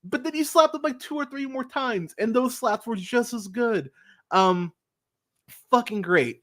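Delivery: high, giddy fan excitement; excited. Real, not acted. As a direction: gush like a thrilled fan. Gushing about the slaps.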